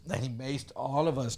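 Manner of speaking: ordinary delivery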